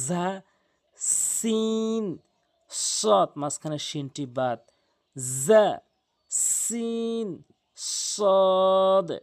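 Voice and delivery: ঝা, সিন stretched high and long, সদ firm; three letters in a row. Shown as a demonstration. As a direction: chant the letters as a drill. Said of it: "তিনটি হরফ একসঙ্গে বলি".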